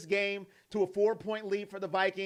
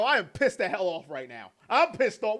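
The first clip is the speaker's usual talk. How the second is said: ordinary talk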